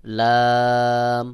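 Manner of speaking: sustained level-note Arabic লাম; open-mouthed লাম. Shown as a demonstration. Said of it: "স্বাভাবিকভাবে মুখ খুলে".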